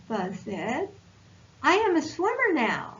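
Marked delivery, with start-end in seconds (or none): 1.6-2.9 proud excitement. Acted. Buzz proud after swimming.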